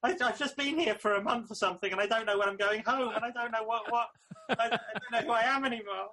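high, laughing mock tearful confession; half-laughing breakdown. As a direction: spill it all out, laughing through it. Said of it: "And you go".

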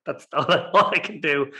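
normal talking voice

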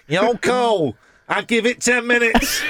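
put-on Manchester accent, laughing. Host repeats the [name] gag to a co-host in a laughing Manchester accent. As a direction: push the Manchester accent up, cracking up. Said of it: "in that accent".

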